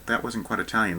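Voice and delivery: ordinary delivery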